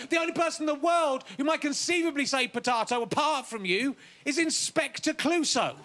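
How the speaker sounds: normal talking voice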